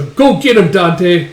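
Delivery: high, loud command; acted rallying holler; urging shout. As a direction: egg a fighter on from afar. Sends a fighter to attack.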